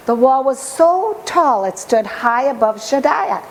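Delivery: ordinary delivery, nothing marked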